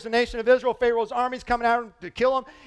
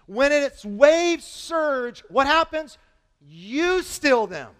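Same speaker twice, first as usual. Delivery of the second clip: slowed dramatic reading; 4 semitones higher, 5 dB louder, much slower than usual. Real, not acted. Preacher reading psalm with drama.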